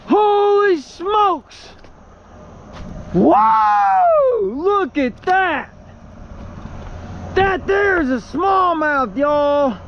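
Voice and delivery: excited. Real, not acted. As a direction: whoop over the catch. Big fish just caught.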